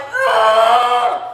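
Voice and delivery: loud pushing grunt; straining groan. Retold or imitated. Mother in labor on the floor, loud straining grunt.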